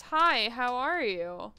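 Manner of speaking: "Hi, how are you?" stretched out slowly; slow clear greeting. Shown as a demonstration. Correcting the misheard greeting, slow and deliberate. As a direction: spell it out slowly, each word apart.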